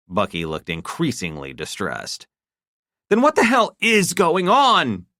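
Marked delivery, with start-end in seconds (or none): 3.1-5.1 high, louder angry demand. A narrator's reading. Wife demanding an answer, angry and loud. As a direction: insist on an answer now. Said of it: "Estelle demanded".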